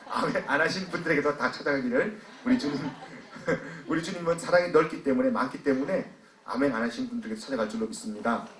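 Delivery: ordinary talk, nothing marked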